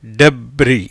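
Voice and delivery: டெப்ரி with silent final S, low; correct pronunciation. Shown as a demonstration. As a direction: say டெப்ரி as "deb-ree". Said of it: "என்னவாயிடுது?".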